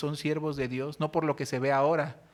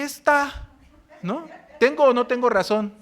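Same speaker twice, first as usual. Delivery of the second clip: high, louder indignant outburst; retold anger; 7 semitones higher, 8 dB louder than usual. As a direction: demand to be proven right. Pastor retells his own anger.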